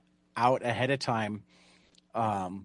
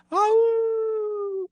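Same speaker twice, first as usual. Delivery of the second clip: a high, mock-surprised crowd "Oh,"; a long, high, mock-amazed "Oh,"; group going oh; 4 dB louder than usual. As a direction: imitate a whole room's surprised gasp. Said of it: "rather than having a group go".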